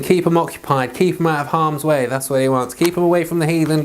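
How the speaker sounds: ordinary talk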